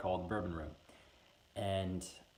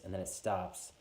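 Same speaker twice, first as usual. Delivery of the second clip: ordinary talk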